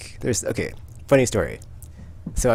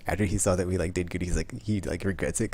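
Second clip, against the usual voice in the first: ordinary talk, nothing marked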